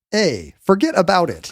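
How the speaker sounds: high, slow "forget about it"; New York accent. Retold or imitated. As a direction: drawl it like a mob movie New Yorker. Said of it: "start saying".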